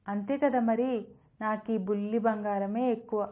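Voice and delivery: usual speaking voice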